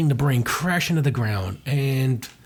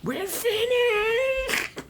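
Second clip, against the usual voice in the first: very high, drawn-out wail; much slower than usual. Acted. Comic character announcing the end, high drawn-out wail.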